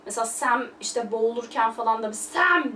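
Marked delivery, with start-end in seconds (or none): 2.1-2.8 a high, loud call of "Sam!"; Frodo's desperate shout. Retold or imitated. Frodo calls out as Sam is drowning, urgent high shout. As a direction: call out "Sam!" sharply in alarm. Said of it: "diye bağırıyor".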